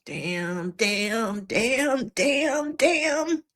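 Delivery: growing realization, damn after damn rising in pitch; building dismay. Retold or imitated. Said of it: "I was like".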